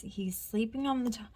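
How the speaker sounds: ordinary delivery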